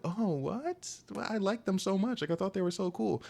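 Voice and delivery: mocking naive voice. Imitating a clueless reply to a beating victim, mocking. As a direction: parody the oblivious fan. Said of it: "and I'm like".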